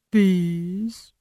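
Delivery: "Bees" drawn out, leering and rising; lascivious. A narrator's reading. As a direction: purr "Bees" with a lewd relish. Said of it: "something lascivious about the way the tree said "bees".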